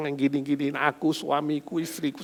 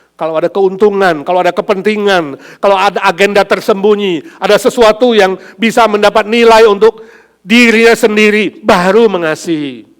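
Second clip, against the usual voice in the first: louder, higher, hammering each clause; preaching at full force; 6 semitones higher, 13 dB louder than usual. Acted. Pastor exposing conditional love to the congregation, forcefully. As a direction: build the list to a climax.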